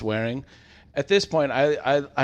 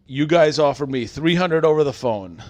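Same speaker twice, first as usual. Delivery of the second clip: retold complaint, louder and higher; indignant customer; 4 semitones higher, 6 dB louder than usual. Customer disputing the shop's offer, indignant. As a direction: imitate a customer loudly insisting on a promise.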